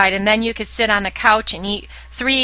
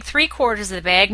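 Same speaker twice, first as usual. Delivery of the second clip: ordinary talk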